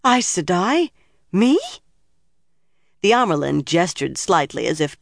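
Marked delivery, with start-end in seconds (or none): 0.0-1.6 high-pitched, incredulous questions; gasped. A narrator's reading. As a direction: ask it in astonished disbelief. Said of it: "Nynaeve gasped".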